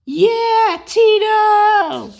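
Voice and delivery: high, loud excited shout; excited cheer. Acted. Family cheering young Michael, high excited shouts. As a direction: cheer him on like a proud family.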